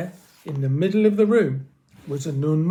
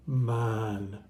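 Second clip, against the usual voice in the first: plain reading voice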